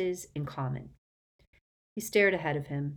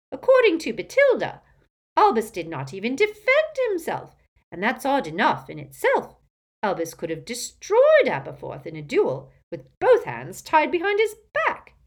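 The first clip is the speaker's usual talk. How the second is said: put-on gossipy old aunt; 7 semitones higher, 9 dB louder than usual. Muriel gossiping loudly.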